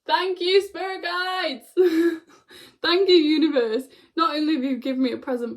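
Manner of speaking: yelling thanks. Retold or imitated. Thanking spirit guides for a gift, yelling joyfully. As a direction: shout your gratitude up to the sky. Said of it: "yelling at my spirit guides".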